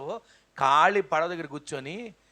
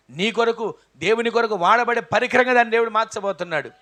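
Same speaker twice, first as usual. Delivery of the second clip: louder, higher proclamation; preaching at full force; 3 semitones higher, 6 dB louder than usual. Acted. Preacher proclaiming victory to the congregation. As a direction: ring out the promise. Sermon climax.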